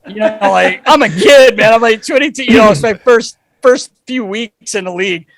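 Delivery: loud, high, worked-up protest. Real, not acted. Host telling the guys he was just a scared kid, loud and high. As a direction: plead your youth, voice climbing.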